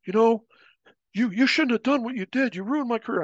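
high, heated blame; lightly acted resentment; mock angry accusation. Disgraced guy confronting narrator in hallway, mock angry.

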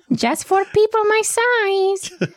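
high tiny-creature voice; squeaky little character voice. Pretend. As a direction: play a small eager salesman. Tiny Ramplu character continues.

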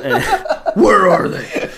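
high, slow hammed-up movie yell; mock dramatic demand. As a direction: drag it out like a parody hero. Parody of movie hero's demand.

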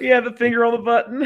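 laughing along at the joke; amused laughing. Real, not acted. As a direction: say it through a laugh. Joking about co-host's sound button.

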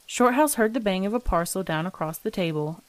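ordinary delivery, nothing marked

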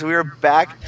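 ordinary talk, nothing marked

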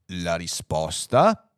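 low, slow questioning voice; ponderous computer. Acted. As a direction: echo it slowly, deep in the voice. (computer grave e perplesso)

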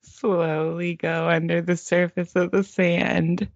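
slow meditation voice cracking into giggles; mock guided meditation. Host guiding co-host into sand, slow and giggly. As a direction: draw it out, fighting laughter.

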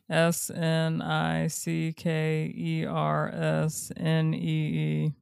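slow letter-by-letter spelling. Shown as a demonstration. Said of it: "spelling the word".

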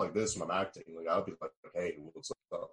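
low, soft baritone; deep acting voice. Shown as a demonstration. Actor showing how he talks when acting, deep low voice. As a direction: drop into a deep, quiet baritone. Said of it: "I have a deep, I'm a baritone".

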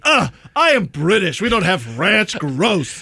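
mock British snob recoiling; hammed-up British accent with a disgusted groan up front. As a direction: sneer it as a fussy Brit. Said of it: "and be like".

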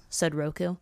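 ordinary delivery

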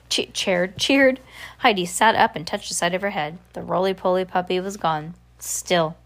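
ordinary delivery, nothing marked

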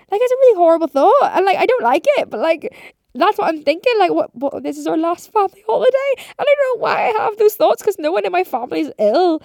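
getting loud and high over her own thoughts; worked up. Real, not acted. Telling viewers about her morbid thoughts, worked up and loud.

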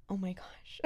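breathless whispered panic; a retold whisper of shock. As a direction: hush it like a frightened new mom.

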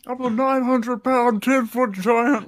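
high, slow hammed-up giant bragging; mock giant boast. Host hamming up the giant's boast, slow and high. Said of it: "every time he says it, it gets a little heavier and bigger".

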